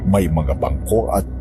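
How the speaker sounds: usual speaking voice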